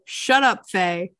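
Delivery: mild mock snap; retold snapping. Game master recapping Saphria to Faye, mild retold snapping. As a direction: retell the snub with a touch of bite.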